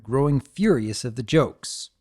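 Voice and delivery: usual speaking voice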